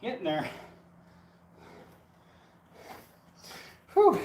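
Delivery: winded and puffing after digging; out of breath, pausing for air. Real, not acted. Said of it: "Gotta catch my breath again".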